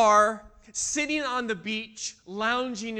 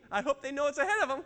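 quick, higher-pitched aside with a laugh; mock blithe aside; 5 semitones higher, much faster than usual. Bystander watching teens float downriver, mock blithe aside. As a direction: chuckle it while pretending to wave.

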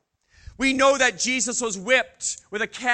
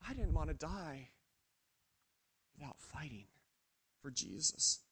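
much softer and lower, nearly gone; hushed despair; 5 semitones lower, 16 dB quieter than usual. Acted. Peter to himself, a hushed, despairing murmur. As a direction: let the words sink to a murmur.

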